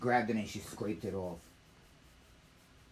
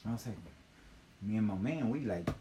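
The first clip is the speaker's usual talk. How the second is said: ordinary delivery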